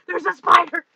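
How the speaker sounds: loud, high frightened exclaim; startled spider cry; alarmed cry. Real, not acted. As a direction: blurt it out in real alarm. Spots spider crawling on wall.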